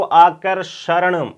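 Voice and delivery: आकर्षण sounded out slowly, र clear in the middle; slow demo. Shown as a demonstration. Teacher asking class to pronounce आकर्षण. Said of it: "उच्चारण करके देखो".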